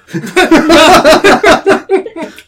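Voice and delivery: high, loud burst of laughter; big high-pitched belly laugh; real laughter. Real, not acted. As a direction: crack up loudly. Host cracks up at wrong answer.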